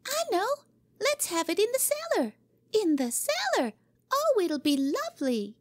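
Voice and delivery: put-on high, eager girl's voice bursting with an idea; a little girl flying in with a plan; excited little girl. Cora to Jimmy, thrilled: excited high girl voice.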